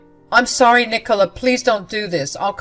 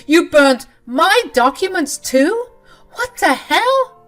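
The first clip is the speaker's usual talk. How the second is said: much higher, louder and slow, cracking disbelief; shocked outraged shout; 12 semitones higher, 9 dB louder, slower than usual. Acted. Husband realizes his work documents burned too, shocked yell. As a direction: shriek it in horror.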